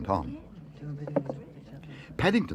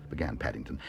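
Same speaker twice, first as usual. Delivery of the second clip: ordinary delivery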